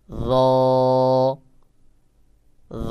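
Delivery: র with a thin onset and round sound, drawn out. Shown as a demonstration. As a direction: demonstrate র thin yet round, lengthened. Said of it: "র পাতলা করে আওয়াজ গোল মতো".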